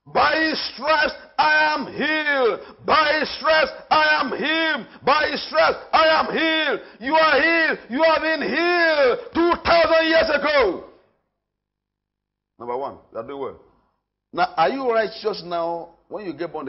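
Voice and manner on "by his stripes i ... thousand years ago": slow, loud, high proclamation; preacher shouting the confession; shouted declaration. Acted. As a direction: hammer each word home.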